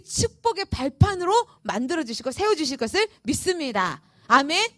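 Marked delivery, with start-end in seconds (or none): none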